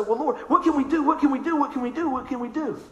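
high repeated plea, fading in volume; lightly acted anxious prayer; mock pleading. Acts out pleading with God.

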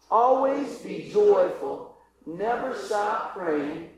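very slow, measured group reading of scripture; reading aloud together. Shown as a demonstration. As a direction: spell out each phrase with the congregation. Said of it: "Can we read this together".

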